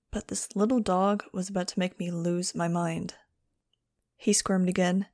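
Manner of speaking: plain reading voice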